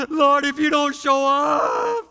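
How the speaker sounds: slow, loud, high mock desperate shout; crying out to God. Preacher acts out believers begging God, loud slow mock shout. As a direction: bellow it like a frantic churchgoer. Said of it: "You start crying out to God".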